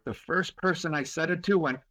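usual speaking voice

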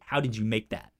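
usual speaking voice

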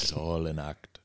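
slow, softened imitation of a hypnotic guru; mock hypnotic. Imitating the fake guru during meditation, hypnotic. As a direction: lull them as a pretend guru, slowly and softly.

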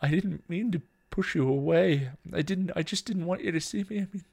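high, broken, distraught; overwhelmed and falling apart; tearful apology. A narrator's reading. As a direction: apologise through tears, fading to a near-whisper. Weeping sisters make up.

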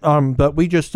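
talking with a mouthful of sushi; words around a bite of food; chewing while talking. Real, not acted. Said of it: "pardon me eating sushi while I talk".

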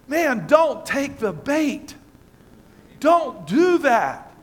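slow, earnest plea not to take the bait; slow pleading. Real, not acted. Preacher pleads with the congregation, slow and earnest. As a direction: draw out "man, don't take the bait" and "Don't do that" slowly.

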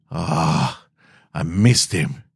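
plain reading voice

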